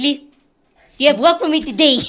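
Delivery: loud, bright-eyed eagerness to the boss; eager quote. Retold or imitated. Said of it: "I'm like".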